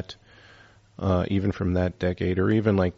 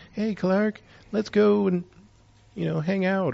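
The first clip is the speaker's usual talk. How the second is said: much higher, lightly voiced sweet woman; flirty woman; 13 semitones higher, 3 dB louder than usual.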